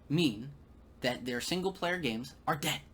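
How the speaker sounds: ordinary talk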